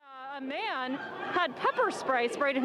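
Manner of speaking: loud urgent live field report; war correspondent. Acted. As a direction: report live over a roaring crowd. Live field report over crowd noise.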